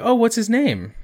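a hint of a cheery young missionary asking; sweet missionary voice. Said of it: "and she's like".

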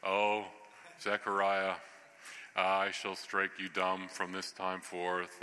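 low, slow, stately British angel; mock-solemn British pronouncement. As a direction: intone it in a deep, grand British accent. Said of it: "like, in a British, like, voice".

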